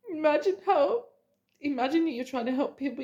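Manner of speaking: crying happy. Real, not acted. Crying while encouraging viewers.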